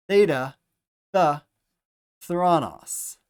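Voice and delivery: theta, th, thronos with a clear th; th sound demo. Shown as a demonstration. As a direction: stress the th in thronos. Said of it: "Theta makes a th sound".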